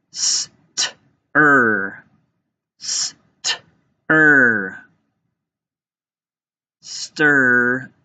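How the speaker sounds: "S, t, er" sound by sound, then blended into "Stir". Shown as a demonstration. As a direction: separate "S, t, er", stretching the er. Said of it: "Let's tap this one out".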